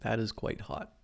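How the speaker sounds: talking right after a slurp; mid-sip, slurping into the mic; slurping hot drink. Real, not acted. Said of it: "sorry to be slurping directly into the mic".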